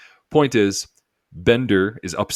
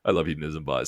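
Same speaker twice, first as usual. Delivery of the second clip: ordinary talk, nothing marked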